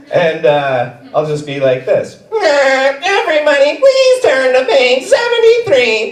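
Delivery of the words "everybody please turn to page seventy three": high, slow cartoon sponge voice; SpongeBob voice. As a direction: chirp it like SpongeBob. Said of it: "I'll bust out my SpongeBob".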